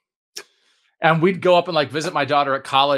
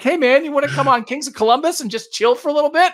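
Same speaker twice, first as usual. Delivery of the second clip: much higher, bright mock-eager pitch; hammed-up chummy pitch to a fellow podcaster; the eager fan inviting the author onto his podcast, mock-excited; eager invite; 14 semitones higher, 3 dB louder than usual. Imagined friendly podcast invite.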